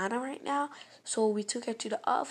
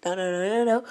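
panicked refusal; 2 semitones lower, 7 dB louder than usual. Retold or imitated. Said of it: "the person was like".

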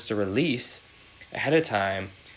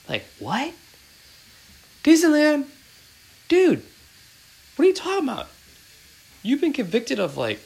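loud, very high-pitched disbelief; incredulous outburst; 6 dB louder than usual. Acted. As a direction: squeal it like you can't believe your ears. Incredulous reaction to killer's Disneyland remark.

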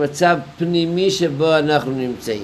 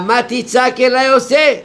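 higher and louder, rising on עושה; emphatic raised question; 8 semitones higher, 5 dB louder than usual. Shown as a demonstration. God rebuking Moses for crying out, raised emphatic voice. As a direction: press the question hard, climbing up to עושה. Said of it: "אומר".

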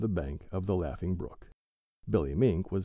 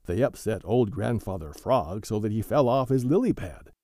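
ordinary delivery, nothing marked

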